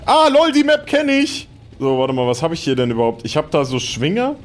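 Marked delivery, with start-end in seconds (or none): 0.0-1.5 begeistert. Real, not acted. Live-Gaming, freudiger Ausruf.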